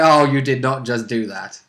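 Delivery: normal talking voice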